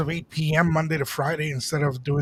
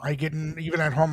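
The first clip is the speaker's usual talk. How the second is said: ordinary talk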